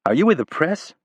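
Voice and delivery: a lightly voiced junior staffer, suspicious; young staffer. Young staffer questions Rapp.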